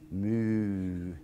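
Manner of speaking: soft low moo like a cow. Shown as a demonstration. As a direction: give a quiet cow moo. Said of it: "moo like a cow".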